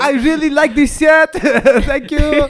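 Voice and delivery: high, loud French accent impression. Mocking a fighter's loud French accent. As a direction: shout it in a put-on French accent. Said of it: "he talks like he has no concept of how loud".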